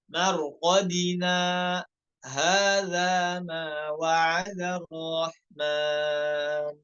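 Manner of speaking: very slow chant with a brief stop; recitation with saktah. Shown as a demonstration. Teacher showing the saktah in slow recitation. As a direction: recite slowly, pausing without breath. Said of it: "terkait saktah ya".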